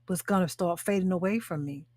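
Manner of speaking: normal talking voice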